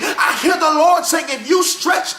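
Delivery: rhythmic preacher cadence, shouted peaks; full-force preaching. Acted. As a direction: preach it like an old Baptist preacher. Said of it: "I felt the old Baptist preacher rise up in me".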